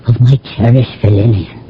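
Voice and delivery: normal talking voice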